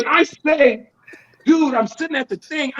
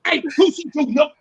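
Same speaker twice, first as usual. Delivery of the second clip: mock fan call-out, higher; imitated fans; 6 semitones higher than usual. Fans spot him at airport, high mock call-out. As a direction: holler at a celebrity in passing.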